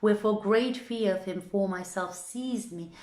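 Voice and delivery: ordinary delivery, nothing marked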